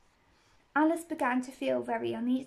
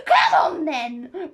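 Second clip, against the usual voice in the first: loud, slow, higher roar; roared command; 4 semitones higher, 13 dB louder, much slower than usual. A narrator's reading. Queen roars at Alice to join in. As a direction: roar it, stretching the words. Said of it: "roared the Queen".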